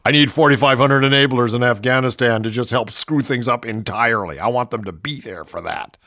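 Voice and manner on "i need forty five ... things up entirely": usual speaking voice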